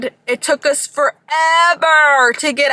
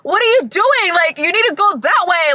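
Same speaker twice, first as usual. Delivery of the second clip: cop yelling; 7 semitones higher than usual. Retold or imitated. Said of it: "The cop's like".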